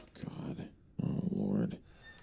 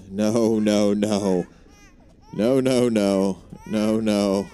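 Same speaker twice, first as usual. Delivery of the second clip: louder, frantic repeated no; panicked loud no; 3 semitones higher, 5 dB louder, much slower than usual. Real, not acted. Streamer fleeing a monster, panicked no. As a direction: refuse in rising panic.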